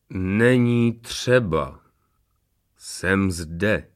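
very slow, stately declaration; grand announcement. A narrator's reading. Late witness reveals himself to the wedding, stately. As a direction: draw out each word for a dramatic entrance. Disguised Fantomas makes dramatic entrance.

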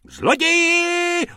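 very high, slow, loud shriek. A narrator's reading. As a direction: shriek Thief!. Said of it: "rozječila se paní z protější trafiky".

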